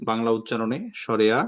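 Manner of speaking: usual speaking voice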